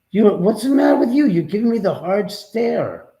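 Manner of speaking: high, loud worried voice to the dog; worried pet talk. Real, not acted. Worried owner talking to dog.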